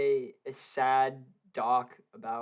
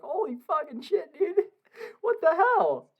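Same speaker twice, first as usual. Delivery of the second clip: mock shocked cry, much higher; stunned outburst; 4 dB louder than usual. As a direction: retell the shock in a squeaky yelp. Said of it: "I was like".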